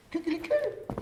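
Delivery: very high, loud nonsense chirp; silly placebo call. Shown as a demonstration. Researchers distracting somebody, very high silly call. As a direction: pipe it out high like a bird call. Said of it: "they were doing like this".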